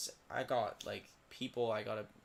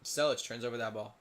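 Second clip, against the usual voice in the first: ordinary talk, nothing marked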